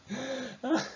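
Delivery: short high laugh at her own mix-up; embarrassed laugh. Real, not acted. Teacher laughing at her own confusion. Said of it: "tô fazendo confusão completa".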